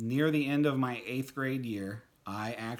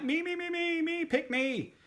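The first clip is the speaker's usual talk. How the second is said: much higher, eager pleading "me, me"; eager volunteering; 3 dB louder than usual. Retold or imitated. Boy volunteering to the new band director, eager and much higher. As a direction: beg to be picked, voice shooting up.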